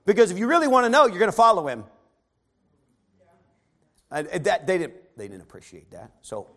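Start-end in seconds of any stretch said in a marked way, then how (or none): 0.0-1.9 loud, pointed jab at the Pharisees; slamming challenge. Retold or imitated. Preacher voicing the blind man slamming the Pharisees. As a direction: slam them with the question. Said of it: "he's slamming the Pharisees".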